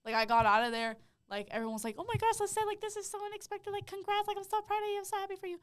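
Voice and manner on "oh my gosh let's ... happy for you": high, squealy congratulating crowd voice; gushing well-wishers. Retold or imitated. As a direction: imitate gushing friends in a high pitch.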